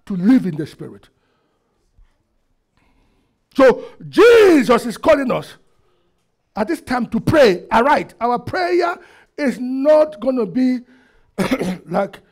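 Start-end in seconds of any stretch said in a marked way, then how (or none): 3.5-12.2 loud, high pulpit exhortation; emphatic preacher's call; forceful preaching. Real, not acted. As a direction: summon the congregation to prayer. Exhorts congregation to pray.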